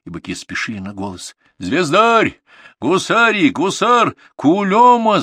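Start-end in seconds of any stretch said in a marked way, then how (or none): 1.6-5.2 loud, very high herding calls; calling animals. A narrator's reading. Grandson keeps summoning his scattered bullocks, hollering. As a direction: sing out each name to carry. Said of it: "звал и звал он своих".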